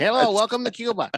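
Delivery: hammed-up Cuban accent welcome; mock Cuban accent. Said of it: "Yeah, it's Cuban".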